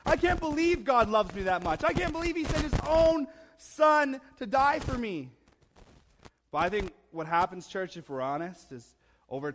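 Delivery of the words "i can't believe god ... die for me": high-pitched, giddy disbelief; wonderstruck, rising high by the end; amazed new believer. Retold or imitated. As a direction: marvel out loud in a lifted voice. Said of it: "walked around thinking".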